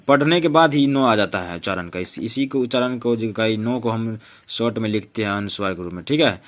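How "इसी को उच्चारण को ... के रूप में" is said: ordinary talk, nothing marked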